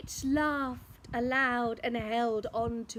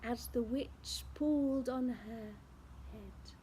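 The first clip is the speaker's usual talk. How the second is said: ordinary delivery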